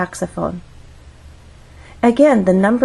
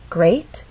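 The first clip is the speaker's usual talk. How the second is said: "Great" glides upward within its single syllable; rising glide; 2 semitones lower than usual. Shown as a demonstration. Teacher demonstrating a one-syllable glide, rising intonation. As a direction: slide the voice up through "Great", like a question. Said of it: "make our voice glide up".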